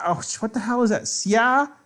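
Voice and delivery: ordinary delivery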